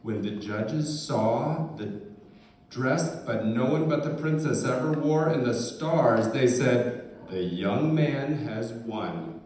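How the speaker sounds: usual speaking voice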